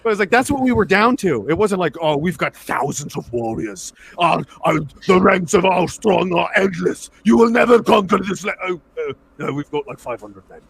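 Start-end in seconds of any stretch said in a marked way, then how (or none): 2.0-8.5 hammed-up leader of endless armies; mock warlord boast. Mock boast of a mighty army. 8.7-10.6 low, soft, mock-deflated voice; sheepish admission. Mock boast collapses into meek admission.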